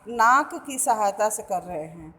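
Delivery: ordinary talk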